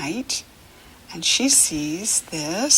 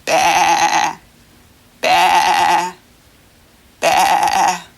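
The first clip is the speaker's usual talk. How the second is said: lamb bleat; 2 semitones lower, 5 dB louder, much slower than usual. Shown as a demonstration. Teacher showing children a lamb's baa, louder. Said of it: "Lambs say".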